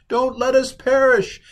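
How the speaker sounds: very high, loud mock plea; hammed-up desperate begging; imploring. Sailors pleading with God for their lives, hammed-up imploring. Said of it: "basically imploring him".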